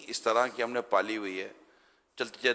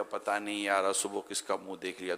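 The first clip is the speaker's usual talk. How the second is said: softer, resigned sigh of complaint; glum grumble; 3 semitones lower, 7 dB quieter than usual. Retold or imitated. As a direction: mutter it sitting slumped after a bad day. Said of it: "آدمی یوں بیٹھے ہوئے بولے گا".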